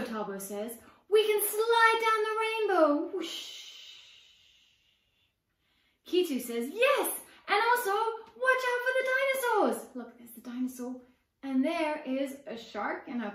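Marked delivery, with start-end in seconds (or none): 1.1-3.1 high, loud, slow gleeful line; excited child. Acted. Brother adding the rainbow slide, excited. 3.1-4.0 breathy whoosh. Acted. Sliding sound effect. 6.7-9.8 put-on very high, loud child voice; gleeful warning. Little sister warning about dinosaurs, gleeful.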